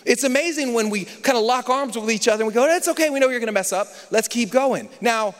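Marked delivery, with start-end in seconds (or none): none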